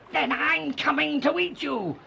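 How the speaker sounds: high-pitched threatening roar; roaring troll. A narrator's reading. Troll threatens to eat the third goat, roaring. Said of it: "roared the troll".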